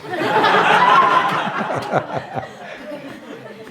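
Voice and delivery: high, airy burst of laughter; hearty laugh. Real, not acted. Laughing at the thief's request.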